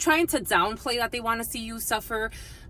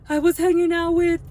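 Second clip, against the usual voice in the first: higher, louder gossipy friend voice; retelling a friend's breathless news, higher and louder; imitating the friend; 9 semitones higher, 8 dB louder than usual. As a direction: mimic her rushing into the gossip. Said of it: "She's like".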